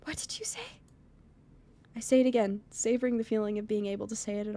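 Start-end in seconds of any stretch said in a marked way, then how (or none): 0.0-0.8 high, hushed disbelief; stunned whisper. Acted. Emmy stunned by the confession, whispering. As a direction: barely get it out, stunned. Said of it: "Emmy seems stunned".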